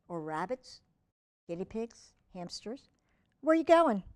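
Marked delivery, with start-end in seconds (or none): 3.4-4.0 louder, higher call after the dog; calling after puppy. Real, not acted. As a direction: call after him as he wanders off.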